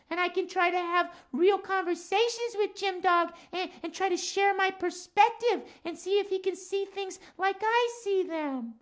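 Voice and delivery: lilting cat character voice; Max the cat. Acted. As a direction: play a chatty cat puppet for children. Puppet cat Max reasons.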